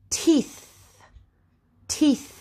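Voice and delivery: TEETH high, ending on a breath of th; unvoiced TH. Shown as a demonstration. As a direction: model TEETH with a voiceless final th. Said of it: "It makes the th sound".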